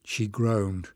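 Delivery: usual speaking voice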